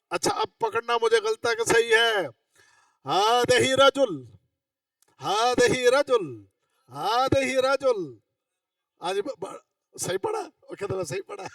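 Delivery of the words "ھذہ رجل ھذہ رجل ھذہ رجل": slow, drawn-out classroom reading; slow recitation. Shown as a demonstration. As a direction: read it out slowly, stretching each word. Reads test phrase for students to judge. (آہستہ، کھینچ کر پڑھنا)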